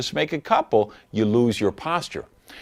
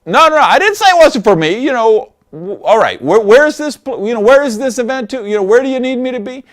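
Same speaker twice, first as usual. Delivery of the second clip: high, loud, rushed mock panic to stay in; eager backpedal; 10 semitones higher, 7 dB louder, faster than usual. Coach mimics a prospect suddenly eager to join, loud and rushed. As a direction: play the prospect scrambling back in. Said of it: "They're like".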